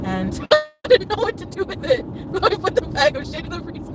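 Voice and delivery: high-pitched, laughing through the words; real laughing fit. Real, not acted. Confessing a gross story to viewers, helpless laughing. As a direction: struggle to talk while cracking up.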